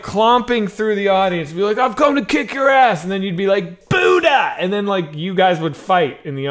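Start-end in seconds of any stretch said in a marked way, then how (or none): 1.8-3.0 raised, high-pitched mock threat; playful sketch-villain bravado; mock challenge. 3.9-4.6 high exclaimed name; big, high sketch-comedy yell; mock battle cry.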